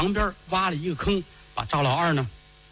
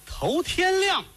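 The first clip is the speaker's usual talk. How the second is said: ordinary delivery, nothing marked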